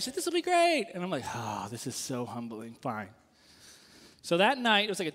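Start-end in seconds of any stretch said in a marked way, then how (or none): 0.2-0.9 much higher, bright cheer; excited wife. Retold or imitated. Wife cheering her husband on about the class, excited. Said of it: "Amy's all excited". 1.3-3.1 low, soft, deflated sigh of a yes; grudging reluctance. Retold or imitated. Student grudgingly agreeing to attend, deflated and low.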